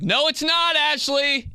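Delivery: high, loud indignant retort; mock argument. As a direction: snap it back way up high. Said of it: "I'd be like".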